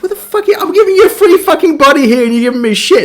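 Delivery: high-pitched, exasperated comeback; indignant outburst. Retold or imitated. Player offering Silverhand a free body, indignant protest.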